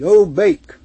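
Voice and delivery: loud pleading chant; crowd crying out. A narrator's reading. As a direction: beg loudly for cake. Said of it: "they cried".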